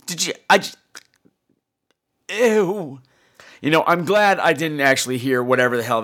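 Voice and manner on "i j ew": ordinary talk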